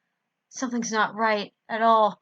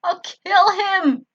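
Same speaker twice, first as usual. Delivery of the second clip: high, loud, slow furious cry; furious, out-of-breath threat; panting fury; 11 semitones higher, 9 dB louder, much slower than usual. Acted. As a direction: spit out a vow of revenge. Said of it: "I panted out".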